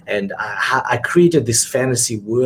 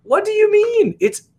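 much higher, incredulous protest; hurt disbelief. Retold or imitated. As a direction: play up the wounded artist's shock. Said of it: "And I'm like".

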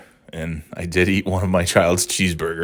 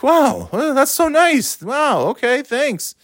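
high-pitched amazed thanks; surprised gratitude; slower than usual. Retold or imitated. Thanking cashier for a paid meal, high surprised gratitude. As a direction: gush in a pitched-up voice.

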